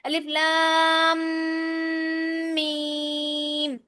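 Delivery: sung الف لام flowing into میم with ghunna; recited with idgham. Shown as a demonstration. Teacher shows students idgham of لام into میم, melodic recitation. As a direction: melt لام into میم, hum the nasal. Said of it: "لام کو میم میں مدغم کرنا ہے".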